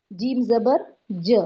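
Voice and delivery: "جیم زبر ج" slow, ending on a short "ج" as ja; demonstrating the a sound. Shown as a demonstration. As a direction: spell out "ج" slowly with a short a. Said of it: "ا ہوتا ہے وہ sound آئے گا". ("ج" کو چھوٹی زبر آواز سے آہستہ)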